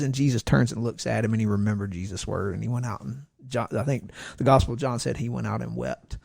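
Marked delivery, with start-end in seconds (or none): none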